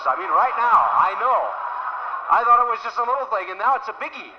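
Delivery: a husband realising the trouble is serious; mounting alarm. Acted. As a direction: build the realisation it's big. Rising alarm in retelling.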